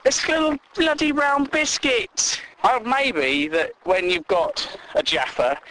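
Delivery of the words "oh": normal talking voice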